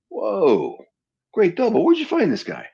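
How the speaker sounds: an impressed star gushing over a lookalike; excited surprise. Retold or imitated. Jean-Claude marvelling at his new double, high and excited.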